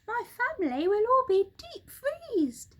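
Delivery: high, anxious monkey voice; high fretful monkey; worried monkey cry. Acted. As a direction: Mugglewump frets about the cold. Mugglewump voice.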